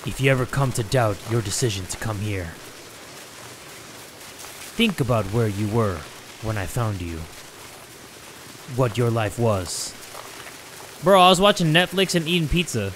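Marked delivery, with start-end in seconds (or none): 0.1-9.9 low, measured voice-over; low and deliberate; solemn game character. Acted. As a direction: deliver it as a grave mentor, pitched down. Reads character's lines.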